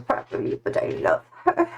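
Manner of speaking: breathless whisper under a heavy cat. Real, not acted. Cat on her chest, whispering love for it. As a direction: whisper it, half-squashed.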